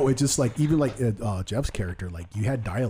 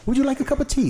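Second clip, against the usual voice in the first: very high, louder mock-British voice; squeaky-high hammed-up British accent; 5 dB louder than usual. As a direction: offer it in absurdly polite fake British. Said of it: "I can't do a creepy British voice".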